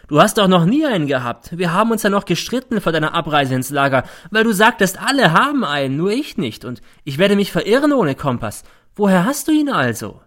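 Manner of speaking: high, loud, taunting reproach; scornful. A narrator's reading. Mother exposing her son in court, scornful. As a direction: press the point with derision. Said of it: "es klingt wie Hohn".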